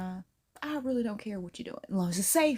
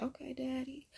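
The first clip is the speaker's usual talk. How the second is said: soft, higher-pitched coy purr; coy swooning reply; 6 semitones higher, 9 dB quieter than usual. Acted. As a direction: give in softly, higher and quieter. Swooning reaction to an accent.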